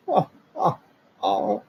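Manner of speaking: high fake sobs; hammed-up ugly crying; mock ugly cry. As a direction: blubber it over and over. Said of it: "ugly cry".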